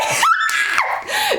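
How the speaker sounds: high, squealing burst of laughter; big high-pitched laugh. Real, not acted. Laughing after mock fight bravado.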